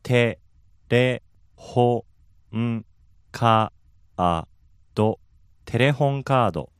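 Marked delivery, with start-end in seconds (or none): 0.0-5.2 テ レホ ン カー ド split into separate beats; syllable by syllable. Shown as a demonstration. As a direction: pause after each mora of テレホンカード. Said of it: "give us the two words individual".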